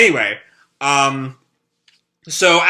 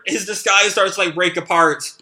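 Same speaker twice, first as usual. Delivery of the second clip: ordinary talk, nothing marked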